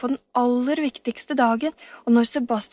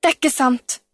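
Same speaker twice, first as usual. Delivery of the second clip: a startled, louder cry of disbelief; 3 semitones higher, 6 dB louder than usual. A narrator's reading. Storpeder, shocked by Camilla's news, cries out. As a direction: blurt it out, shocked. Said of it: "ropte han forskrekket".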